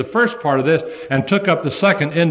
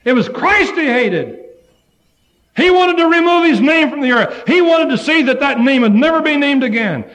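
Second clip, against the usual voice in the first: high, loud preaching cry; fiery preaching; 10 semitones higher, 4 dB louder than usual. Acted. Preacher declaring Saul's aim to the brethren, high and loud. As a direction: hammer it home.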